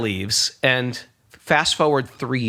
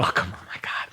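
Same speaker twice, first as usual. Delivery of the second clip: whispered, exasperated groan of words; hushed dismay; 5 semitones lower, 8 dB quieter than usual. Retold or imitated. Musician hearing the same doom prediction, hushed dismay. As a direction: let it out under the breath, defeated.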